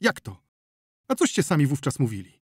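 outraged doctor demanding answers; indignant. Acted. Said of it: "z oburzenia krew uderzyła do głowy". (z oburzeniem)

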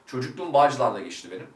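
ordinary talk, nothing marked

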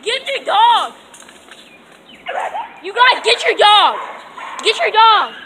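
very high, loud yelling; high-pitched alarmed yelling; shouting. Real, not acted. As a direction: yell at the neighbors to get their dog. Yelling at neighbors about dog.

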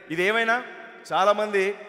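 ordinary delivery

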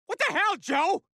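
high, agitated exclamation; outraged protest. Acted. To the friend who fired, outraged. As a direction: protest in angry shock. Just saw friend shot.

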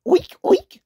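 high, loud imitated oinks; two squealed mock oinks; pig's oink. A narrator's reading. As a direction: oink like a storybook pig. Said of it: "said pig".

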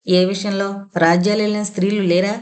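ordinary delivery

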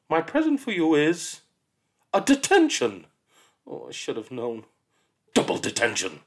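spring the trap with relish; gloating teacher. Acted. Miss Seeth gives the birthday child a detention, gloating.

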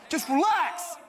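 very high strained shout; panicked mock yell. Prank caller to rioting cinema crowd, high panicked shout. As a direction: scream it at an angry crowd.

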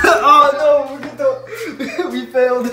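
high, loud laughing words; helpless laughter. Real, not acted. Telling someone we failed to get them in, laughing hard. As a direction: crack up while getting the words out.

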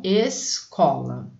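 Escola said slowly, syllable by syllable: es-co-la; slow syllable reading. Shown as a demonstration. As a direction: draw Escola out gently for the children. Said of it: "a palavra escola". (Escola bem devagar, sílaba por sílaba)

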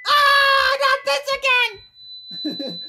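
high-pitched enraged shriek; furious scream. Acted. Swearing at the alarm noise, furious scream. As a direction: scream it at the top of your range.

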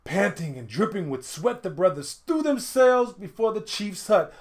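ordinary delivery

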